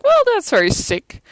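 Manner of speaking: high, loud appalled reaction; mock shock. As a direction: blurt it high, playfully scandalized. Retelling shocked reaction to kiss.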